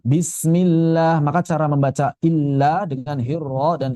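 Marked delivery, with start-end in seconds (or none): 0.0-1.2 bismillah drawn out with a flowing lam; slow recited bismillah. Shown as a demonstration. Teacher modelling bismillah for the class. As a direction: recite bismillah slowly, letting the lam flow. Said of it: "huruf bainiyah di lam".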